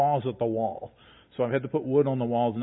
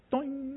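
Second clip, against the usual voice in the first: imitated thump; 12 semitones higher, 8 dB quieter than usual. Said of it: "Just that little".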